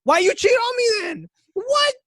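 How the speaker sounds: high loud disbelieving yell; incredulous shout. Retold or imitated. Confronting a cheating girlfriend, high incredulous shout. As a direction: demand an answer, outraged.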